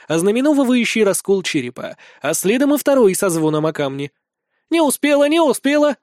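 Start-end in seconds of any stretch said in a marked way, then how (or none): 4.7-6.0 high, sing-song taunt; gleeful teasing. A narrator's reading. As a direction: tease her as if laughing at her. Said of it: "смеётся Эмира".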